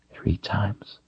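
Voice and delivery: breathless shocked whisper; whispered in disbelief. A narrator's reading. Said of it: "he whispered".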